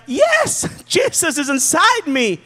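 high shouted yes then laughing; mock thrilled shout with a laugh; excited believer. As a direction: cheer it like new faith. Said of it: "we are like".

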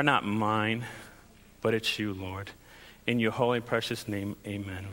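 hushed, earnest prayer to God; quiet prayer. Real, not acted. Pastor praying quietly before the sermon, hushed and earnest. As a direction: pray softly, head bowed.

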